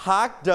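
"hugged" as hug-duh; separated final d. Shown as a demonstration. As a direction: release the d of "hugged" as its own sound. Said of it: "we pronounce the".

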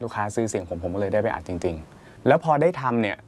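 usual speaking voice

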